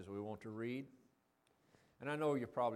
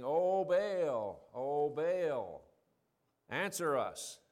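raised, drawn-out cry to a god; measured, chanting appeal, raised in pitch and volume; calling out; 3 semitones higher, 5 dB louder, much slower than usual. Acted. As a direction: call out loudly and plead for an answer. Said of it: "could have probably put more emotion".

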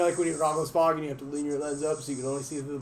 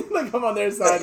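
laughing; 6 semitones higher, 6 dB louder than usual. Real, not acted. Laughing after being shot.

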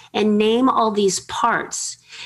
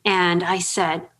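ordinary delivery

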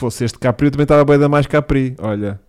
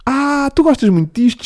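teasing; 12 semitones higher than usual. Acted. Teasing Chico in a high voice.

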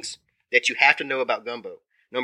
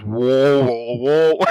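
loud croaky frog call; frog call whoa; 5 dB louder, much slower than usual. Shown as a demonstration. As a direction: mimic a bullfrog croaking whoa. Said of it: "that frog that goes".

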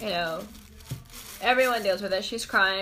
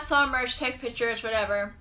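normal talking voice

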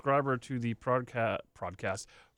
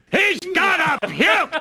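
laughing shout; 13 semitones higher, 8 dB louder, much slower than usual. Pretend. Mock yelling bit, laughing.

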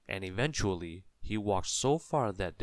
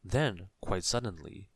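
plain reading voice

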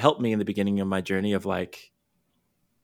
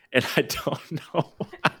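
laughing; 5 semitones higher than usual. Real, not acted. Laughs at losing her thread.

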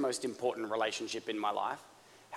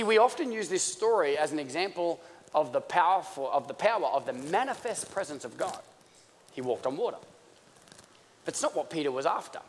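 ordinary talk

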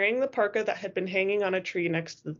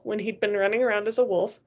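ordinary delivery, nothing marked

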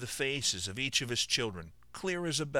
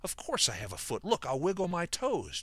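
insisting; 8 semitones higher than usual. Acted. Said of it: "Job insisted".

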